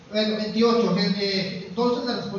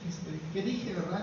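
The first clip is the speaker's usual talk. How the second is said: sheepish afterthought; 2 semitones lower, 10 dB quieter than usual. Retold or imitated. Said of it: "Y ya después como que".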